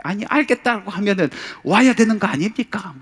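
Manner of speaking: high, loud, exasperated protest; indignant complaint. Retold or imitated. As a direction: demand it, fed up. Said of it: "그러는 거예요".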